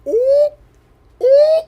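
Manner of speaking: high delighted squeal; excited squeal. Real, not acted. Reacting with a squeal to the show.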